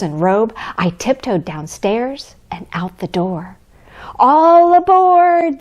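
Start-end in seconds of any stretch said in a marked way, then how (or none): 4.2-5.6 high, loud, drawn-out call; cried out. A narrator's reading. Conductor calling to the boy, loud drawn-out cry. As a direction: call the whole train aboard. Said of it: "the conductor cried out".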